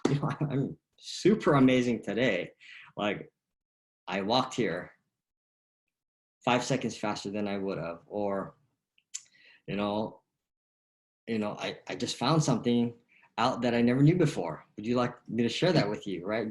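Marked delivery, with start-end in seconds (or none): 0.1-8.0 louder, lifted "I'm super amazing today"; excited answer. Shown as a demonstration. Coach shows the enthusiastic answer players should give. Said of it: "Get excited about things". 11.6-16.2 loud, eager, lifted "I just found something out"; excited discovery. Shown as a demonstration. Coach models an eager reply to "How you doing?". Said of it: "Get excited about things".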